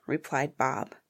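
ordinary delivery, nothing marked

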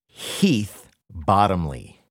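drawn-out big reveal; slow announcer reveal. Acted. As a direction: announce the name slowly, grandly. Announcing surprise guest slowly.

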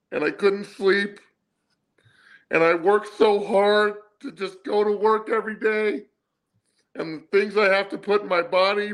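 high, whining mock sob of a sulking quarterback; mock whiny quarterback. Host mocks the quarterback's interview in a high whine. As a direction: whine like a pitiful crybaby.

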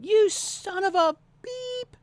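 high, drawn-out mock yell with a self-censoring beep; mock road-rage yell. Driver cursing someone cutting them off in traffic, mock yelling. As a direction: stretch it out high, like a censored curse. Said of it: "Driving down the road and yelling".

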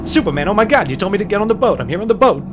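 high, loud flustered pleading; panicked protest. Acted. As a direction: protest frantically to the man hounding you. Mobster pleads, shocked at cabin door.

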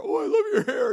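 very high, loud imitation of an admirer; hammed-up, overcome squeal of praise; mock emotional gushing. Lady in the store overcome by his hair, mock emotional gush. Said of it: "she gets all emotional".

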